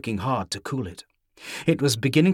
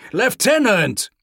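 loud desperate call; shouting; 9 semitones higher, 5 dB louder, slower than usual. A narrator's reading. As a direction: shout it again, more urgent. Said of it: "shouted Pavel from across the hall".

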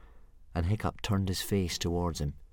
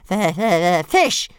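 much higher, louder little dragon hiss; 11 semitones higher, 9 dB louder, slower than usual. Acted. Baby dragon Toothless demanding fish from Hiccup, hissing. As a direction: hiss it like a hungry hatchling. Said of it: "hissed Toothless".

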